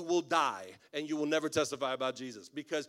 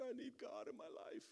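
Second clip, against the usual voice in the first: high, soft mock-tearful plea; imitated weeping; 8 semitones higher, 15 dB quieter than usual. Weeping stranger tells the pastor he needs God, imitated tearful voice. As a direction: put on a small, high, weepy voice. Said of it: "this guy starts weeping".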